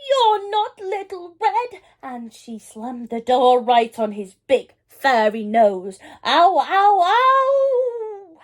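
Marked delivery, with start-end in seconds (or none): 0.1-1.8 high, alarmed shout; frightened granny. A narrator's reading. 6.2-8.4 high loud yowl; wolf yelping after the door hits his nose; howl of pain. A narrator's reading. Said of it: "howled the wolf".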